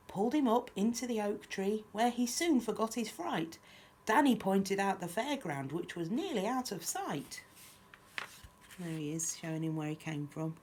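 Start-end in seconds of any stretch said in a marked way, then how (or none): 0.1-7.4 lively, lilting picture-book reading; children's story narration. A narrator's reading. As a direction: read a rhyme aloud to little ones. Reads picture book aloud.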